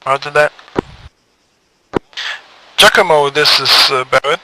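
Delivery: loud radio call-out. Acted. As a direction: call the unit over the net. Leader on radio.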